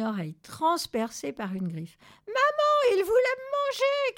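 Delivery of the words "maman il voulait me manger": very high, loud squealed complaint; screaming chick. A narrator's reading. Chick tells mother about the attackers, screaming. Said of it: "cria le poussin".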